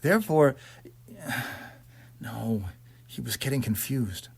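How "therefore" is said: plain reading voice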